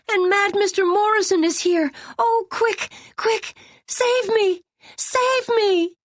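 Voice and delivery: frantic, high, crying for help; desperate crying plea to be rescued; panicked pleading. A narrator's reading. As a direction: beg to be saved in terror. Trapped girl begging rescue.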